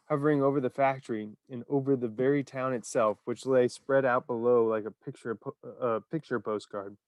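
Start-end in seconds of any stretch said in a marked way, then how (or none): none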